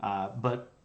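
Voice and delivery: ordinary talk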